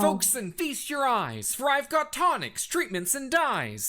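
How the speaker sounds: slow, rhyming carnival barker call; hammed-up medicine show spiel; showman's pitch. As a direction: sell it like a snake-oil doctor to a crowd. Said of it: "Mister Doctor Delight".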